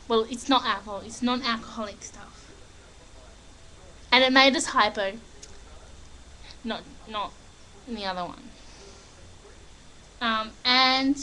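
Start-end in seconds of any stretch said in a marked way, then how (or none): none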